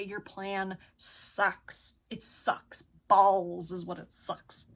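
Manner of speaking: flat dragged-out verdict; slow deliberate put-down. Real, not acted. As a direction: spell it out slowly and coldly. Blunt put-down of plan.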